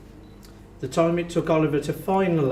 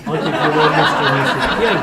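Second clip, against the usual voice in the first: laughing; 8 dB louder than usual. Real, not acted. Joke punchline, laughing.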